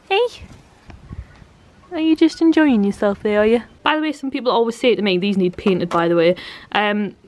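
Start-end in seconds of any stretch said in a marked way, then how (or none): 0.1-3.6 pet talk. Real, not acted. Talking to pet under basket.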